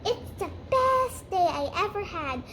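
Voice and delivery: lightly acted girlish delight. Anne delighted while playing princess.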